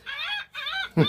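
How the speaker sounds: very high, soft squawking cackle; mock laughing gull cackle; gull laugh imitation.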